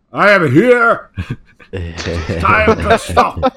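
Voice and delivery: big booming god-like voice; magically amplified voice. Retold or imitated. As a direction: boom it out like a voice from the sky. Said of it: "he's got a big booming voice as well".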